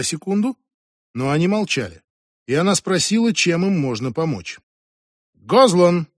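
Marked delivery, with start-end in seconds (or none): 5.3-6.1 sharp louder call with some reproach; stern one-word reprimand; commanding, reproachful. A narrator's reading. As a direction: call him to order, reproving. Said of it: "бросила капитанша религиозному приказным тоном, не без доли упрёка".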